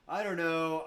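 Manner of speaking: loud, high put-on dithering; mock hesitant customer. Customer backing out of classes, loud mock dithering. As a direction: ham up a customer making excuses.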